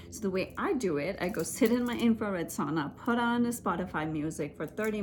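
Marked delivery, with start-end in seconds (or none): none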